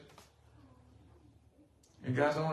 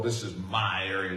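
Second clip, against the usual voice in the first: mock demon voice; 6 semitones lower than usual. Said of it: "they hold on to places in the world".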